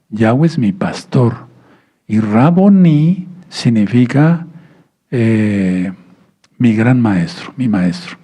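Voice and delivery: ordinary talk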